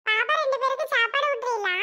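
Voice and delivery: put-on young boy asking his big sister; little boy. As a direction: play the little brother, curious. Little brother arrives and asks.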